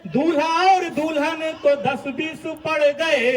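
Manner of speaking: loud, rhythmic stage verse, rising at line ends; comic verse recitation. Acted. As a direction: belt the comic couplets out to the crowd. (ज़ोरदार लयबद्ध हास्य कविता पाठ)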